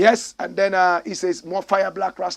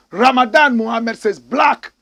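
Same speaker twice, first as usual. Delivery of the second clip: loud, raised announcing of the name; announcer call-out; 6 semitones higher, 12 dB louder than usual. Acted. Host reading a viewer's comment aloud, announcer call-out.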